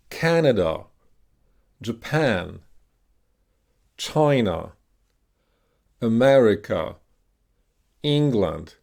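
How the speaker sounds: each word alone, its stressed syllable punched and long, then falling. Shown as a demonstration. Teacher demonstrating a punch movement, stressed syllables punched. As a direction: punch CAN in Canada, PAN in Japan, CHI in China, MER in America, ENG in England. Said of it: "on the stress. So like this".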